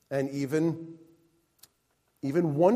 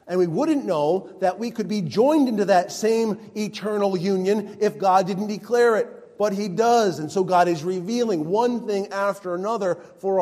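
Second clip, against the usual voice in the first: raised, louder, driving sermon voice; full-force preaching; 6 semitones higher, 6 dB louder than usual. Acted. Pastor pressing the truth on his congregation, full-force preaching. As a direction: preach it out with rising conviction.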